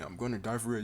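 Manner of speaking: normal talking voice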